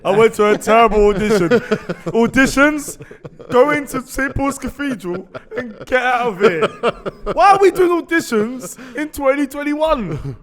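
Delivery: loud, high laughing rant. Real, not acted. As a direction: vent it out, cracking up. Ranting about terrible audition.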